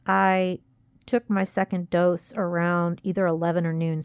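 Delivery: normal talking voice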